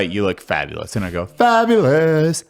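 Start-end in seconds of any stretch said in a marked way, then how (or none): 1.3-2.4 loud, high, drawn-out camp exclamation; jesting. Retold or imitated. Joking with friends while dressed up for a skit, jesting. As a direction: stretch it out big and high, hamming it up. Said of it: "I do it in a jesting manner".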